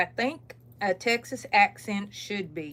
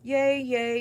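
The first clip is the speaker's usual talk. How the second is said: high, loud little cheer; real excited yay; happy cheering; 5 semitones higher, 6 dB louder than usual. Real, not acted. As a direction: cheer yourself on with delight. Cheering her own colour choice.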